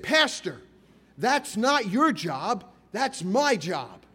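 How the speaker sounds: put-on slow, loud, scolding old caretaker; stern reprimand. Old caretaker scolding the new pastor, loud and stern. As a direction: scold the young pastor firmly, word by word. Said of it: "and reprimanded me".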